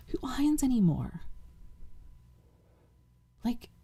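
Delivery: breathy unvoiced aside; whispering. Real, not acted. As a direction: whisper it to the viewers.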